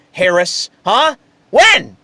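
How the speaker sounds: usual speaking voice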